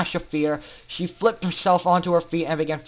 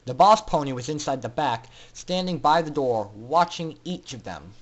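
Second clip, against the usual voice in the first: ordinary delivery, nothing marked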